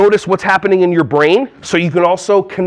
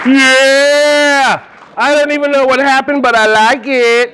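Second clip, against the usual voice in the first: excited cheer; 5 semitones higher, slower than usual. Real, not acted. Reacting to a volunteer's performance.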